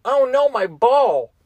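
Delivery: high, slow "Oh no, my ball."; heightened voice. Retold or imitated. Dropping the ball mid-game, high slow mock dismay. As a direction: lift the pitch and stretch the words. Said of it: "and I just go".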